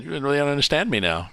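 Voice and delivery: a light hint of a bad Russian accent. [name] mocking the friends, light Russian accent. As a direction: tease in a fake Russian accent. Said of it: "English with a bad Russian accent".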